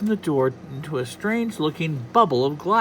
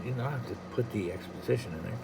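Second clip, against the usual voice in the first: usual speaking voice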